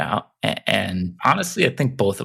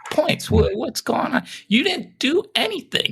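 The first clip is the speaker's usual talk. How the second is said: very high, squeaky protest; mock outraged whine. Rival driver confronting his favored teammate, high mock outrage. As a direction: complain way up high, in comic disbelief.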